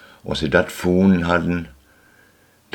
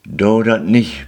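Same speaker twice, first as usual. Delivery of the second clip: pleading; 6 semitones higher, 6 dB louder, slower than usual. Acted. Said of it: "bitte et".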